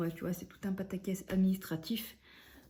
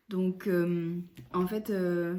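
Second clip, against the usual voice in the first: usual speaking voice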